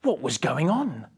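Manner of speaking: ordinary delivery, nothing marked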